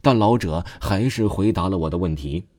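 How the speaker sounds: usual speaking voice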